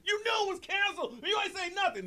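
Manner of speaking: high, accusing shout; angry passenger. Retold or imitated. Angry passenger accusing the gate agent, high yelling. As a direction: throw the blame at the agent. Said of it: "somebody said".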